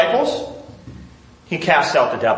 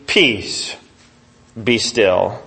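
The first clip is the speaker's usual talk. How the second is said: deep command; 8 semitones lower, 3 dB quieter, much slower than usual. Acted. Jesus rebuking the sea.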